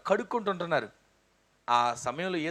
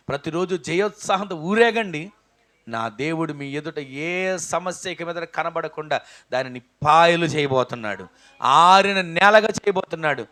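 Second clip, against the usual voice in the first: louder, rising sermon cry; preaching at full force; 8 dB louder than usual. Acted. Preacher crying out God's victory to the congregation. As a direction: build the promise to a ringing shout.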